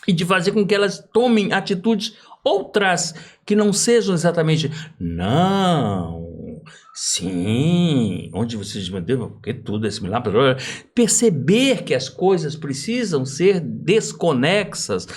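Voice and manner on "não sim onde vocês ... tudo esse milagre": lower, mock-literal voicing of illustrative gestures; mock gesture talk. Actor mocking gestures that merely illustrate, lower mock voice. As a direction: parody the obvious, low and dry.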